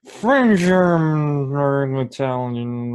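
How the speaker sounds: high, drawn-out mumbling bluff; evasive improviser. Pretend. Improviser dodging fan's accent question, hammed-up evasive mumble. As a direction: start loud, then fudge the answer in a long strained hum. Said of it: "And you can be like".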